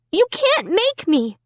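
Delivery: high, trembling defiance; scared defiance. A narrator's reading. Amy defying the dummy, high and scared. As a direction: hold out despite the fear.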